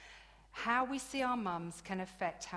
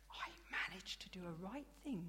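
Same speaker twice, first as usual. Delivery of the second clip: smug whisper; 8 dB quieter than usual. Real, not acted. Smug confession aside.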